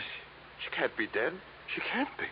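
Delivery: breathless, whispered denial; stunned disbelief. Acted. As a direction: barely get the words out in shock. Man shocked by death.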